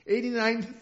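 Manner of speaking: ordinary talk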